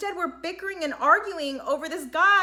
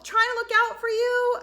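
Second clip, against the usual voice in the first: raised, high-pitched protest; exasperated plea; 10 semitones higher, 5 dB louder than usual. Acted. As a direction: plead it louder and much higher. Exasperated answer to imagined sister.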